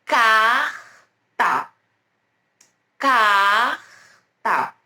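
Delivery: "Carta" stretched on a throaty R, pause, then ta; R e T definidos. Shown as a demonstration. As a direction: model "Carta" slowly, R and T clearly apart. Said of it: "o som do R e o som do T bem definidos". ("Carta" com R de garganta longo e T separado)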